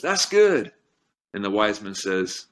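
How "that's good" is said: higher, louder pleased exclaim; relieved crowd. Retold or imitated. Villagers in the parable relieved the boy is spared, higher and pleased. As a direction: villagers suddenly approving again. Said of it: "everyone in the community says".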